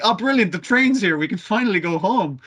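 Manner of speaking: mock delighted relief. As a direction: sell the joy of finally going home. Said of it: "the humans are like".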